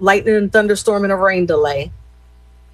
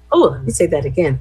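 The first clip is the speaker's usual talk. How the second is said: ordinary delivery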